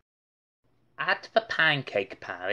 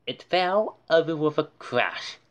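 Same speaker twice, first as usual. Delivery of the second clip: ordinary delivery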